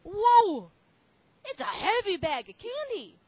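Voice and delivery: high loud amazed strain; surprised whoa. Acted. Policeman lifts the candy bag, surprised whoa. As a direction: react to the weight of the bag.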